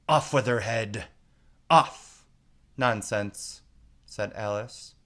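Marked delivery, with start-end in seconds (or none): 0.1-2.3 furious queen screaming, rising to a shriek; screamed. A narrator's reading. Queen screams in fury at Alice. 2.8-3.6 louder, firm and final; loud and decided. A narrator's reading. Alice answers the raging queen loudly and decidedly. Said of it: "very loudly and decidedly".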